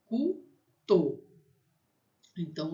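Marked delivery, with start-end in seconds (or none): 0.1-1.2 Cuto split slowly, with a high rounded French U. Shown as a demonstration. Teacher models the vowel for learners, syllable by syllable. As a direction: say Cuto in two separate syllables, ending on O. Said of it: "O U tem som de U".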